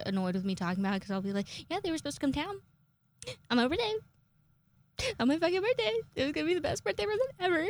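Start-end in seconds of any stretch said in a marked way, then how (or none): 1.7-7.6 high-pitched, pleading lament; mock whining. Complaining to people sick of hearing it, high mock whine. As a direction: wail it high, hammed-up. Acts out own birthday complaint.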